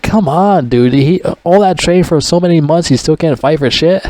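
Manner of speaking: exasperated groan. Retold or imitated. Vlogger scolding hero after months of training, fed up. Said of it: "I'm like".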